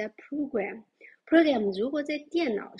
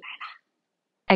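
much slower and louder; slow, drawn out; 9 dB louder than usual. Shown as a demonstration. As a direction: draw the words out. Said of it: "teacher stretches out the ending".

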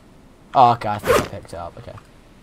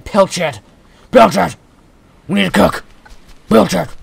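high, loud playful shouting; mock battle yell; 7 semitones higher, 10 dB louder than usual. Gamer relishing the fish's cool name, mock shouting. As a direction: bellow it like a silly war cry.

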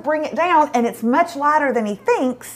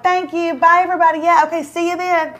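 high, sing-out polite goodbye; put-on pleasant, higher pitched; fake cheerful farewell; 5 semitones higher than usual. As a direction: keep it sweet while hiding the pain. Feigned cheer before the car outburst.

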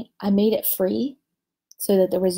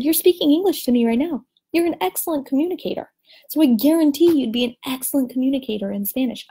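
higher, fast and insistent reassurance; teacher retelling how she encouraged a discouraged mother, pitched up; encouraging; 6 semitones higher, 4 dB louder, faster than usual. Retold or imitated. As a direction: pump her up with conviction. Reassuring the crying mother, retold.

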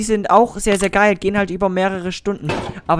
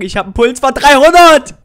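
higher, louder, still rattled from the scare; shaken and loud; 6 semitones higher, 13 dB louder than usual. Real, not acted. Player tells viewers his pulse is racing, loud and shaken. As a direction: blurt it out with your heart racing. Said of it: "Ich hab mich so erschrocken".

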